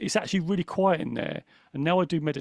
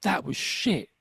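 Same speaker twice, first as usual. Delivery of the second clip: self-critical voice; 3 semitones higher, slower than usual. Retold or imitated. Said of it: "I say out loud".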